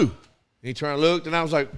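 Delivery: ordinary delivery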